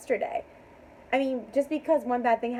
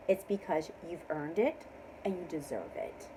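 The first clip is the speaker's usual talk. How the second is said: ordinary delivery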